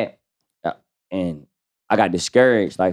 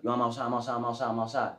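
quiet phone call; 3 semitones higher, 12 dB quieter, faster than usual. Retold or imitated. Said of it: "Blow his shit up".

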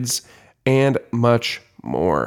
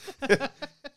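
high giggle; 13 semitones higher, 7 dB quieter than usual. Real, not acted. Mom can't keep straight face.

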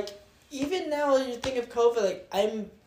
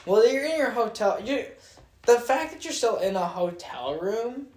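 usual speaking voice

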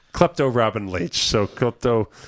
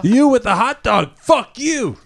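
put-on loud, higher drunk heckle; mock heckler; 8 semitones higher, 5 dB louder, slower than usual. Fan heckling a fighter at the bar, loud mock jeer.

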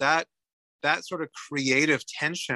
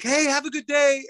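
much higher-pitched imitation of a passing greeting; retold cheery call-out; 12 semitones higher, 4 dB louder than usual. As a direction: call it out brightly, high and a little louder. Retold cyclists' friendly greeting in passing.

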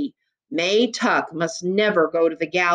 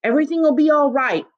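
measured, higher-pitched repetition; repeated slowly; 6 semitones higher, faster than usual. A narrator's reading. Man echoing the girl's reassurance, slowly. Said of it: "Tuck repeated slowly".

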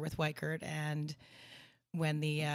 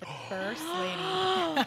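grand announcement; 13 semitones higher, 4 dB louder, much slower than usual. Pretend. Playful grand title.

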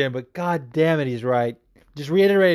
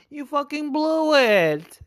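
much higher, slow De Niro-style scold, BLEW hammered; Robert De Niro impression; 12 semitones higher, slower than usual. Mocking the fighter for blowing his chance, in a De Niro voice. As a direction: channel De Niro chewing someone out, stretching out "blew". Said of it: "as Robert De Niro would say".

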